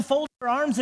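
ordinary delivery

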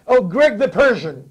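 slow, proud English 'Greg the Persian'; a kid proudly introducing himself in English; English-accented self-introduction. Retold or imitated. Iranian-American kid introducing himself in an English accent. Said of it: "به خودش میگه".

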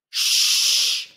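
long, loud "Shh" hiss; sh sound demo. Shown as a demonstration. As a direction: hold "Shh" for learners to copy. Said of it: "I want you to say".

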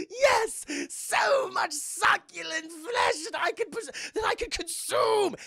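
high, slow, loud hungry demon voice; gloating villain, drawn-out and loud; Mark Hamill demon. Retold or imitated. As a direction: savor every word like a flesh-eating monster. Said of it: "the Mark Hamill demon pops up. He's like".

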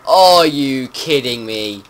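loud, slow disbelieving yell; real outraged shout. Real, not acted. Mob ruins his game.

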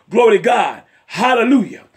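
usual speaking voice

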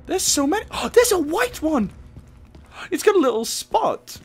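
excited cooing. Real, not acted. Player spotting little rats, high-pitched delight. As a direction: coo over a cute little animal.

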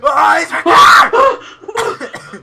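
terrified drawn-out scream; scared horror game streamer. Real, not acted. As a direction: scream slowly in terror. Sees the monster.

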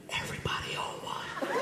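breathy unvoiced whisper in the ear. Friend whispering in his ear at homecoming, hushed. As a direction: whisper it like a secret, imitating a friend. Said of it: "He, he whispers in my ear".

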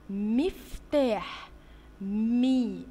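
مفتاح dragged very slowly, then مي; slow sounding out. Shown as a demonstration. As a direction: stretch the word for kids. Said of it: "صوت حرف الميم". (نطق مفتاح ببطء)

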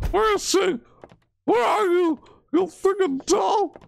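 high, shrill-pitched frantic yelling; shrieking at the doll. Real, not acted. Frightened gamer shrieking at the doll in the dark, high frantic yell. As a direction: scream up at the unseen doll, rattled.

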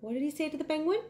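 ordinary delivery, nothing marked

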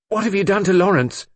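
high, loud alarmed question; frantic demand. A narrator's reading. Brenda asking the inspector about Lawrence, frantic. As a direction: demand an answer in rising panic. Arrested woman demands after her lover.

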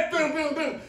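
imitated banging on the stall door; pounding door knocks.

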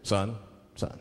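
deep, measured cop voice with long pauses; low, deliberate officer at a traffic stop; police officer. Acted. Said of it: "If the police pull me over".

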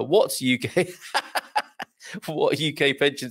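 breaking up laughing mid-question; helpless laughter. Real, not acted. Host reading Penny's pension question, overcome by laughter. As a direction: try to read it out but crack up. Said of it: "I don't know why I'm laughing".

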